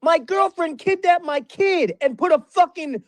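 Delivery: high, heated tirade hurled at Ryan; furious rant. Real, not acted. Ranting at Ryan over his slander, furious and high. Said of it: "that was strong".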